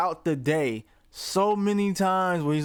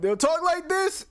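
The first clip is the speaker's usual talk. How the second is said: much higher, mock streamer squeak; high voice demo; 12 semitones higher than usual. Shown as a demonstration. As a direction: pitch way up to make a point. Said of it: "when they're trying to make a point".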